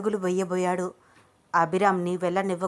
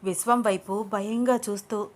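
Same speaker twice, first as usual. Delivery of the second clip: plain reading voice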